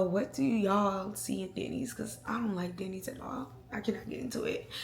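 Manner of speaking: a confiding whisper about not liking Denny's; hushed whisper to the viewers; whispered aside. Real, not acted. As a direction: lean in and whisper it like a secret.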